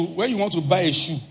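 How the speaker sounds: ordinary talk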